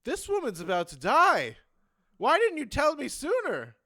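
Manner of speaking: a doctor yelling in panic at the nurse; alarmed shout. Acted. The doctor yelling at the nurse in alarm.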